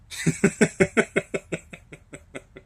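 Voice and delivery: long, high burst of laughter; helpless laugh. Real, not acted. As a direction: laugh it out until breath runs down. Laughs at being seen as crazy.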